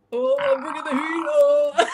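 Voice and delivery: high, loud, hammed-up flustered reply; mocking own answer. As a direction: parody your own failed answer. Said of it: "better than, like".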